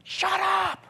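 retold scream. Stranger screaming at a crying baby, sudden high yell. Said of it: "got about this far from Samantha's face and said".

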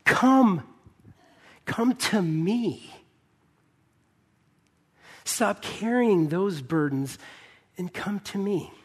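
slow, drawn-out, pleading invitation; Jesus' gracious invitation. Acted. Jesus inviting the weary to come and rest, slow and tender. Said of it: "And he's saying".

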